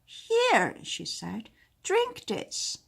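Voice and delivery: very high witch handing over a bottle. Acted. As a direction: hand it over, high and crisp. Witch hands over castor oil.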